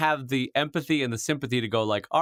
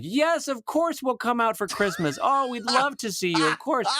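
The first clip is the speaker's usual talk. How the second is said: higher, gushing fake phone call; pretend phone chat; 10 semitones higher than usual. [name] vamping on a fake call to grandma, gushing. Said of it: "two, three minutes of vamping".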